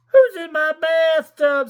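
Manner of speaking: high, loud startled shout; startled bather. Acted. Someone finding a seal in their bathtub, shouting. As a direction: yelp it from the bathtub. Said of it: "said someone".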